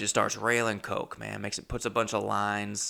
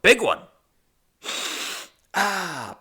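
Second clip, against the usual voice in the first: excited; 8 semitones higher, 4 dB louder, much slower than usual. Real, not acted. Boasting about big line.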